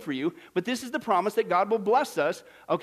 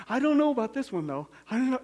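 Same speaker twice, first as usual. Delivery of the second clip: higher, wavering mock doubt; doubtful; 4 semitones higher than usual. Believer unsure of God's promise, doubtful. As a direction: hedge it as a reluctant doubter. Acts our doubt versus Paul's.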